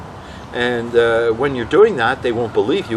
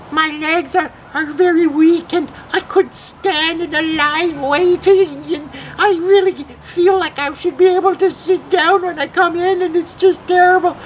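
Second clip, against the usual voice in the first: put-on little old lady; 4 dB louder than usual. Prank caller pleading weak legs to the host, little old lady. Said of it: "little old lady voice".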